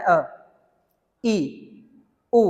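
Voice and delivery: usual speaking voice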